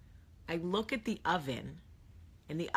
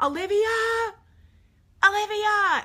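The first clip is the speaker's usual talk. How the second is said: very high, loud drawn call; calling out frantically; 11 dB louder, slower than usual. Retold or imitated. Mom searching soccer field for her daughter, frantic calling. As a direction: holler the name across a field.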